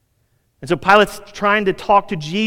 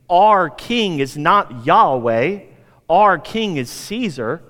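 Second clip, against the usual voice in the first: slow emphatic declaration; 3 dB louder, much slower than usual. Retold or imitated.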